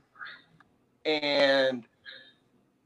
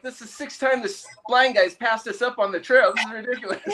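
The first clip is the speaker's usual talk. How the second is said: higher, amused disbelief; exasperated hikers; 9 semitones higher than usual. Retold or imitated. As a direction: hikers marveling at being passed. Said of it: "They're like".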